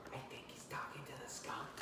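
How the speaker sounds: hushed aside whisper. A narrator's reading. Teacher whispering a side comment about the skunk. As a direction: whisper it behind a paw.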